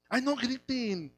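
soft, drawn-out mock plea; mock nervous complaint. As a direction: act out someone bothered by noise, softly. Mimics someone bothered by shouting. (queja fingida, suave y alargada)

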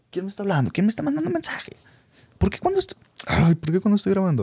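hammed-up high-pitched complaining about notifications; mock exasperated whine. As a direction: squeal at your phone in mock annoyance. Imitating himself annoyed by notifications.

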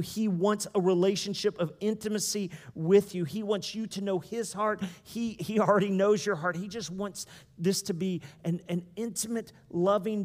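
usual speaking voice

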